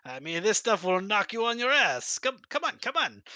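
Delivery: high, eager broken-English host; dictator voice. Acted. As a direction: sell the barrel with pushy charm. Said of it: "this dictator voice".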